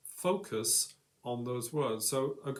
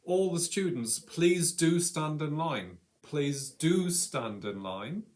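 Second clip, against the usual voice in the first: drawn-out, authoritative stress on do; emphatic teacher's order; 3 semitones higher, 4 dB louder, slower than usual. Acted. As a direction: DO stressed in a slow, firm request. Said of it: "So the emphasis o-, on the word do".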